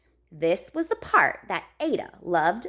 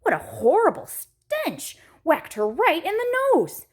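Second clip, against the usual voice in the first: plain reading voice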